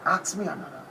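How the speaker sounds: usual speaking voice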